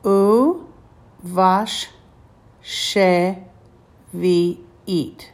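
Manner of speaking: U vash she vi'it split into separate syllables with gaps. Shown as a demonstration. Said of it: "read this word slowly, syllable by syllable".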